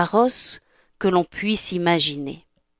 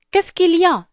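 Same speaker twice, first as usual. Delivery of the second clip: fairy godmother; 10 semitones higher than usual. Acted.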